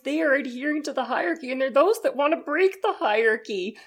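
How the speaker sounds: pompous lecture on hierarchy; Jordan Peterson impression. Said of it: "scarily good impressions from both of you".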